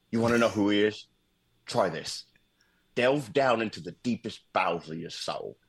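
lightly voiced Michael Caine. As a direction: lean in like telling a ghost story. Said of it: "Michael Caine answers with".

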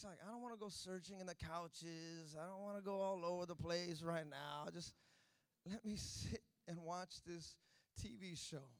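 normal talking voice

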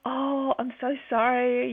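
gently hammed-up sorry; retold apology. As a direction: act out saying sorry, slowly. Retells repeated apology to midwife.

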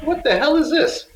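high, stunned what-the-hell; mock shock at a weird call; shocked disbelief. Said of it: "I was like".

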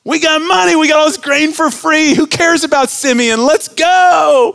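high, loud gleeful shout; wicked brothers gloating. Retold or imitated. Preacher mocking greedy brothers, gleeful shouting. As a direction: ham up greedy glee at free loot. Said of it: "they would go".

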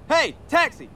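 high call rising to a shout; hailing a taxi. Acted. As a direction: flag down the cab. Brody hails another cab.

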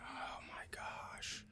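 hushed whispered gasp; whispering. Real, not acted. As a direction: breathe it out in a whisper. Reacting to gambling memory.

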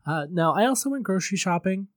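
usual speaking voice

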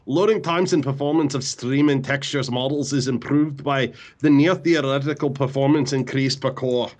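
lightly voiced Scottish engineer; a hint of Scotty's Scottish accent. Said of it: "can you try to do this in your Scotty voice".

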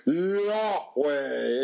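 imitating a classmate's hammed-up booming Yahweh; mock deep voice. Schoolboy pranking classrooms down the hall, mock deep Yahweh. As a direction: ring out Yahweh like a voice from above. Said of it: "and in a deep voice".

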